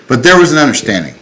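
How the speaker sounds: ordinary talk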